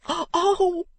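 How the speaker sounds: high, drawn-out groan; pained groan. A narrator's reading. Beaten man clutching his head, groaning. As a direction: let out a wailing oh of pain. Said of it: "groaned Mister Fledgeby".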